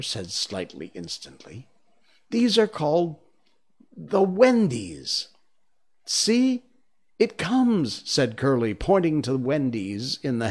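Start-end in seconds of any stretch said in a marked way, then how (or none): 2.3-8.1 high-pitched wondering exclamation; eager, high calling out; excited boys. Acted. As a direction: announce the sighting in a raised boyish voice.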